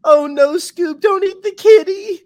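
high, loud Shaggy-style wail of defeat; mock despair, imitating a beaten man. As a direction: plead in a high, drawn-out voice. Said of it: "he says it like a defeated man".

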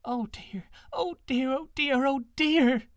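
from a whisper to a high, loud wail; girl's weeping rising to a cry; building weeping. A narrator's reading. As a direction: let the sobbing swell with each oh dear. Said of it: "she wept".

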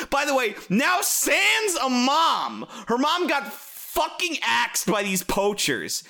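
high, slow, shouted aside; outraged shout. Real, not acted. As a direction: cry out in mock outrage.

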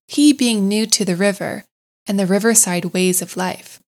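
ordinary delivery

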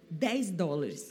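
voz grave de vendedor. Retold or imitated. Vendedor diz o preço.

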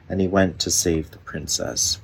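ordinary delivery

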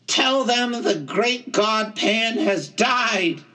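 high, slow, drawn-out proclamation; long, high, heralding cry; mysterious voice crying out. A narrator's reading. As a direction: call the news out from a distant shore. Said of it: "a mysterious voice crying out".

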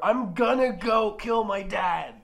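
slow, loud, cheerful menace; slow menacing line. Acted. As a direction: drag it out, cheerfully sinister. Character's dark line, slowed.

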